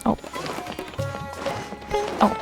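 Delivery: ordinary delivery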